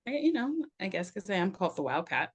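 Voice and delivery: ordinary talk, nothing marked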